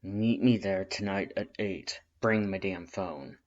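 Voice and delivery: slow, gritted-teeth order; Red curt and annoyed; through gritted teeth. Acted. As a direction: grind it out. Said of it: "Red ground his teeth".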